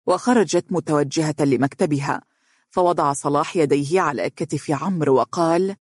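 ordinary delivery

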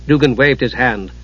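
usual speaking voice

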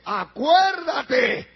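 loud, drawn-out shout; calling out a reminder. Acted. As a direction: cry the word out slowly, stretched long. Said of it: "Porque le dice".

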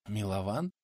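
soft, faint questioning call; breathed out. A narrator's reading. Pensioner coming round, calling companion, faintly breathed. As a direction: barely breathe out the name as a question. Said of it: "выдохнул пенсионер".

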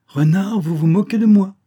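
high, loud indignant protest; indignant rebuff. A narrator's reading. Mésange rebuffs the fox.

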